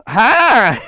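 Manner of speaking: put-on loud squeaky high child's "Hi!"; high, loud little-girl greeting; little niece voice. Shown as a demonstration. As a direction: chirp it out like a tiny excited kid. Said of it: "Rocket is based on my niece Kalara's voice".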